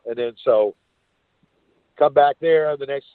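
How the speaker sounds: usual speaking voice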